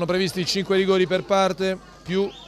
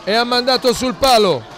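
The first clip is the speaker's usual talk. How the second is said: loud, high shout of palo; excited penalty call; 3 semitones higher, 4 dB louder than usual. Real, not acted. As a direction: cry out as the ball hits the post. Penalty hits the post.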